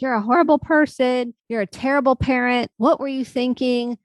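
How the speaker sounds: mock-scold yourself like a harsh critic; berating inner critic. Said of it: "Instead of berating myself like".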